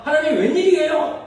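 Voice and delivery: mock protest. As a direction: act out Job's indignant complaint.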